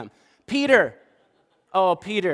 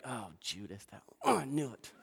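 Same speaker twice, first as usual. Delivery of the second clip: 1 knowing Peter; 7 semitones lower, 13 dB quieter than usual. Retold or imitated. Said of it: "You can just see Peter like".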